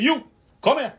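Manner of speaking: a cop snapping at the speaker to come over, loud command; retold officer's shout. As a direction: bark it like a cop calling you over. Officer barking order in retold story.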